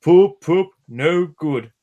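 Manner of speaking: loud, high poop-poop whistle then grumble; grumbling engine. A narrator's reading. Gordon answers Edward's whistle, loud grumbling hoot. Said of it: "grumbled Gordon".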